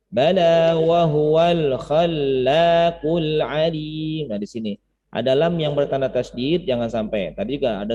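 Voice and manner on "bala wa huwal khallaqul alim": slow, chanted Quran reading; Quran recitation. Shown as a demonstration. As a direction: recite the verse slowly, melodically. Recites the verse. (tilawah Al-Quran perlahan)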